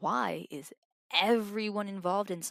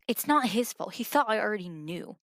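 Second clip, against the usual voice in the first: raised voice; 3 semitones higher, 7 dB louder than usual. Acted. Defending friend, heated argument.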